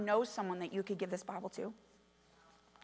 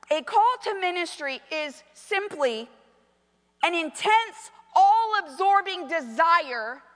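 loud, raised, slow proclamation; big deliberate preacher's voice; preaching; 7 semitones higher, 9 dB louder, slower than usual. Acted. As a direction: declaim the quotation with full pulpit force.

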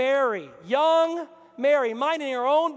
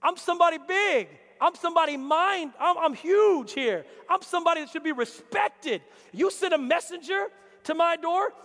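higher, louder indignant boast; offended Naaman; 6 semitones higher, 4 dB louder than usual. Retold or imitated. Naaman protesting the messenger, loud and indignant. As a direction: puff up like a wounded big shot. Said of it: "He got offended".

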